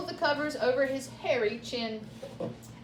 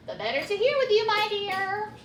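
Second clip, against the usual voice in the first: higher, fast sweet granny voice; wolf in granny voice; 7 semitones higher than usual. Acted. Wolf pretends to be grandmother, sweetly high. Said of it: "said the wolf".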